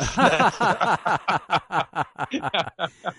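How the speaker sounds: long, high chuckling laugh; amused rolling laughter. Real, not acted. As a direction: laugh heartily in a long run. Guest laughs at own joke.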